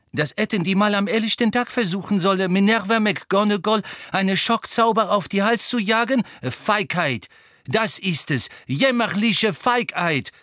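high, loud, scornful tirade; indignant outrage. A narrator's reading. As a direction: rail at the attackers, drawing out the last insult. Nurse indignant at attack on colleague.

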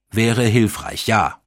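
mildly grim, firm reply; grim agreement. A narrator's reading. Agreeing he should look for the number, grimly. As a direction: agree with a touch of grimness. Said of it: "stimmte ich grimmig zu".

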